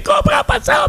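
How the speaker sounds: very high-pitched mock outrage; imitating enraged woman. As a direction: squeal it like a furious woman on the phone. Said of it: "Elle s'est enragée".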